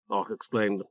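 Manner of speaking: ordinary delivery, nothing marked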